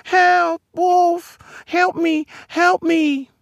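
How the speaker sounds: very high, frightened creature wail; wailing for help. A narrator's reading. Creature stumbles away from the wolf, wailing high. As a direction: wail each cry in a squeaky high voice. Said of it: "Its horrible mouth wailing".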